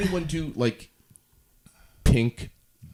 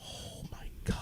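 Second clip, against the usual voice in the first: whispered; 16 dB quieter than usual. Real, not acted. Awed by the total.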